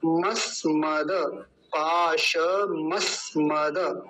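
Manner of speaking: "पाश मस्मद" said very slowly, every syllable given its full value; slow, precise chant-like "पाश मस्मद"; full careful pronunciation. Shown as a demonstration. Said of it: "पूरे अक्षर को पूरा बोलेंगे".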